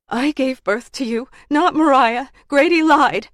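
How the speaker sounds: high voice building to loud; urgent, rising declaration; desperate confession. Acted. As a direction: insist harder with each phrase.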